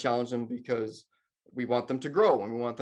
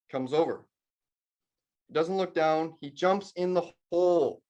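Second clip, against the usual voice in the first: ordinary delivery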